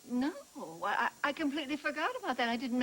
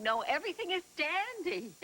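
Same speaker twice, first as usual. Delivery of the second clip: usual speaking voice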